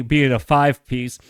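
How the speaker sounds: talking through a yawn; words stretched by a yawn. Real, not acted. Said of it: "I don't know why I'm yawning".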